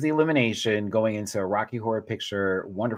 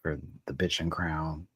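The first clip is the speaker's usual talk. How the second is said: ordinary delivery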